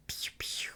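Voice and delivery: whispered pew pew; whispered laser noise. Pretend. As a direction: breathe out a soft laser sound. Mimicking laser gun sounds.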